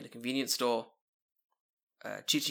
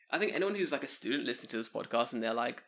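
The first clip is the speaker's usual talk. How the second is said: ordinary delivery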